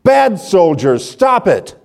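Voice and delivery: put-on very high, loud furious child's scream. Girl screaming at soldiers hurting Jesus, high angry shriek. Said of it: "she screamed".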